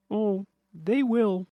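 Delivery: slow, high, knowing; sly and ominous. Acted. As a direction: tease what's coming. Billy's ominous reply.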